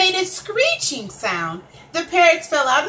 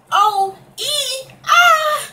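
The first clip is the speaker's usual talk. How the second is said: strained squeaky would-be roar, rising to a high shout; a lion trying hard to roar but squeaking; failed roar attempt; 13 semitones higher, 6 dB louder, much slower than usual. A narrator's reading.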